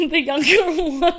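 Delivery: real laughter breaking through the words; laughing. Real, not acted. Laughing while retelling the border story. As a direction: crack up while telling it.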